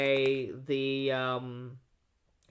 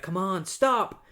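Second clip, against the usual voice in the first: louder, higher, drawn-out plea; mock panic; 5 semitones higher, 5 dB louder, slower than usual. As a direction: act out pleading with a runaway car. Ashley's car will not stop.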